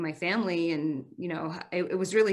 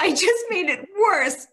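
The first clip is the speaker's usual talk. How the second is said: dismayed cry; 12 semitones higher, 6 dB louder than usual. Retold or imitated. Retelling her burned skin from icy hot.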